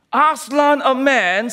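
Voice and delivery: indignant beaver voice; stern Mister Beaver. Acted. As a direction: scoff like a shocked beaver. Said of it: "said Mister Beaver sternly".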